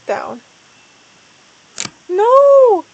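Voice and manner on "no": very high, loud scream. A narrator's reading. Starpaw watching Lakepaw fall, screaming. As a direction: scream it with all your heart. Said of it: "Starpaw screamed".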